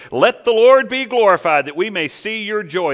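sarcastic quote, starting loud and easing off. Acted. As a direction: throw the praise out as a taunt. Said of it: "That's sarcasm".

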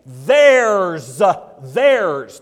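"Theirs" punched very loud and drawn out, falling; emphatic THEIRS. Shown as a demonstration. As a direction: hit "Theirs" like an exclamation mark. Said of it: "like we put an exclamation mark behind something".

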